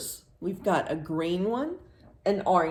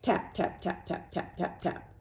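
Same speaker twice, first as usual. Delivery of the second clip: whispered tap tap, like a beak on wood; woodpecker tapping; 5 dB quieter than usual. Shown as a demonstration. Showing children a woodpecker's sound, whispered tapping. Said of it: "do you know what they sound like?".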